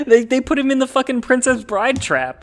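laughing while talking, high and loud; words bubbling out through laughter. Real, not acted.

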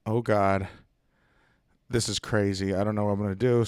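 low, soft nervous mumble under the breath; quiet, nervous aside to yourself; nervous mutter. Retold or imitated. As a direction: mutter it like you're scared. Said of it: "And I'm like".

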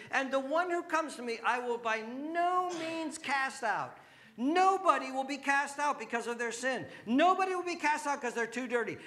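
high, loud, shouted gospel invitation; full-force preaching. Real, not acted. Preacher urging doubters to come, shouted and high-pitched. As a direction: cry it out at the top of your voice.

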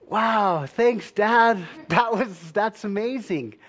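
hammed-up "wow" reaction, slowing at the end; mock amazement. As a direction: act out a kid's overdone thanks. Boy reacts to unwanted calf gift.

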